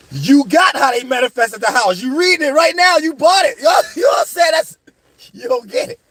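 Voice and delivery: high-pitched, laughing disbelief; rising, incredulous mock reply to a clueless buyer; incredulous outburst. Telling off a gullible book buyer, incredulous and high-pitched.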